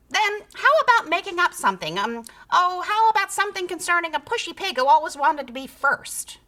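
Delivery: high, fast bossy suggestion; coaxing creature. A narrator's reading. Creature to the tired pig, coaxing. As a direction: push the idea quickly.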